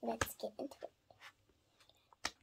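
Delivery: soft whispered kickoff; a quiet whisper to begin. Real, not acted. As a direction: breathe it out as a hushed start. Starting the reading video.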